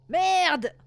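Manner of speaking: loud exasperated swear; frustrated swear. Real, not acted. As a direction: spit it out in frustration.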